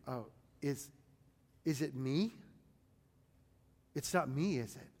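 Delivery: soft, low, hesitant self-questioning; hushed acted self-doubt; introspective. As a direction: ask it quietly, doubting yourself, with pauses. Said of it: "they all got introspective".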